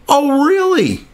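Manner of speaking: normal talking voice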